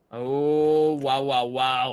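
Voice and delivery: loud, awed wow; amazed. Real, not acted. Impressed by signed weapon.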